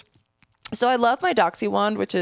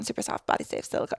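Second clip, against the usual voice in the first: fast whispered tongue twister; 8 dB quieter, faster than usual. Shown as a demonstration. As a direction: rattle off "Super soft, body safe silicone" in a quick whisper. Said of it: "try saying that five times fast".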